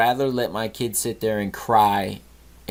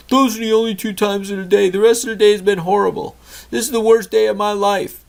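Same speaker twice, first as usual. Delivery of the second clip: put-on much higher, louder child's wail; whiny upset son; 10 semitones higher, 5 dB louder than usual. Son insists to dad it's the worst day, higher whiny kid voice. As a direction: protest like a kid who's had the worst day.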